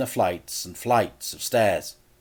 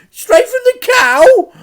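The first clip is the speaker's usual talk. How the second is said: very high, slow warbly auntie; an outraged old lady; Auntie Flip, shrieking; 18 dB louder, slower than usual. A narrator's reading.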